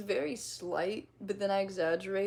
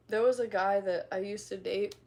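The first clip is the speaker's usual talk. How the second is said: ordinary delivery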